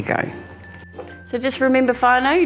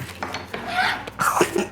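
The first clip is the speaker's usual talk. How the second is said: breathy laugh; 6 dB quieter than usual. Real, not acted. Onion fumes too strong.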